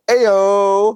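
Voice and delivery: high, slow, stretched-out cry; mock call-out. Host milking a misread product name, mock call-out. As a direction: draw the last syllable out high.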